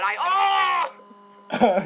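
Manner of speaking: loud, high drawn-out "oh"; sudden loud mock wail; acted cry. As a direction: yell out a long hammed-up groan. Third take of acted groan.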